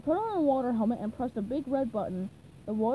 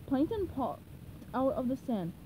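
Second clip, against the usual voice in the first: plain reading voice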